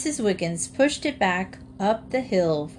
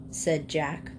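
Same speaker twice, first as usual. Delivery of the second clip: plain reading voice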